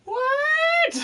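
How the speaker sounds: sudden, very high shocked "What?"; a high-pitched stunned yelp; shocked squeak. Retold or imitated. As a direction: squeak it out in disbelief. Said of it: "And I was just like".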